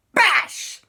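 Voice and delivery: high, loud smashing noise; bash impact. Acted. Papa bashes the ghost, loud impact shout. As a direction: burst it out as a blow. Imitates a blow.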